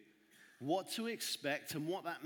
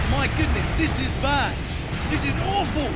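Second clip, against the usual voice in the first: loud, high, frightened cry; panicked shout; 9 semitones higher, 16 dB louder than usual. Acted. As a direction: yell it in terror over the waves. Panicked fishermen in the storm.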